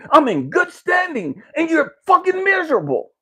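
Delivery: heated sermon-like rant; building rant. Real, not acted. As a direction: keep pushing the anger up. Escalating rant.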